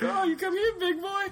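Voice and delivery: very high falsetto come-on; flirty streetwalker. Acted. Voicing game courtesans luring guards away, high mock-flirty voice. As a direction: coo it in a mock seductive falsetto.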